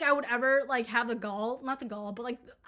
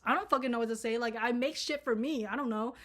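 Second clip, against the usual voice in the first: ordinary talk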